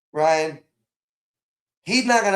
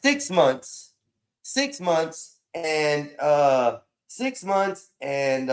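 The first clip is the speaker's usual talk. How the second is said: drawn-out count; 3 semitones lower, much slower than usual. Real, not acted. Stretching out the count.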